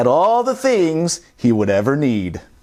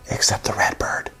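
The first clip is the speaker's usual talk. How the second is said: soft unvoiced whisper; 5 semitones lower, 5 dB quieter than usual. Real, not acted. Whispering the bird to the child. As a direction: hush it like a secret.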